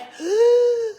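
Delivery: loud high gasp; gasping for air. Retold or imitated. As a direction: gulp a big breath after a run. Out of breath after running.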